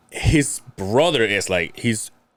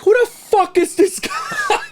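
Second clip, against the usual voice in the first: high, slow, laughing mock outrage; laughing impression; 4 dB louder, slower than usual. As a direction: act out the drunk friend through laughter. Tipsy Waldo glaring at a stranger.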